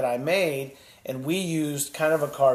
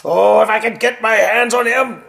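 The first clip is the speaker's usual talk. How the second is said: loud, furious threat; angry king; 6 semitones higher, 10 dB louder than usual. Acted. King furious at the dragon that burned the castle, loud angry threat. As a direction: seethe at the dragon.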